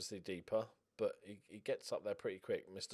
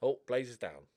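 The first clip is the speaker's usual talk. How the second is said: normal talking voice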